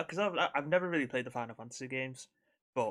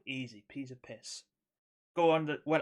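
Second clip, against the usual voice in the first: ordinary talk, nothing marked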